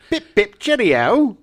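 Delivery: high, loud, slow silly Englishness; mock English accent. Host teasing the actor's fake accent, in mock English. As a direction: camp up a fake English toff. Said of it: "the Cockney accent".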